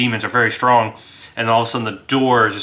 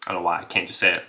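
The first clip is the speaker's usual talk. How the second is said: ordinary talk, nothing marked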